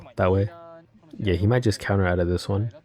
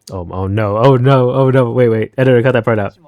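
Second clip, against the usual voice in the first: mock panic; 2 semitones higher, 6 dB louder than usual. Mock panic, begging the editor.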